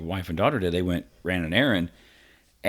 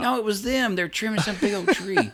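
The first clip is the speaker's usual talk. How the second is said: laughing; 12 semitones higher than usual. Real, not acted. Laughing at the tree crew.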